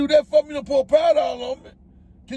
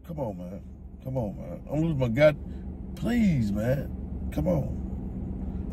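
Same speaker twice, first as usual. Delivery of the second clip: low, soft pleading; asking nicely; 10 semitones lower, 8 dB quieter than usual. Real, not acted. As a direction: beg quietly, holding it together. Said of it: "I'm asking nicely".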